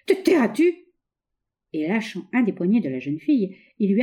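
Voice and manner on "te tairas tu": high, loud raging demand; furious shout. A narrator's reading. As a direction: bandit in a rage at the girl. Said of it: "s'écria-t-il avec rage".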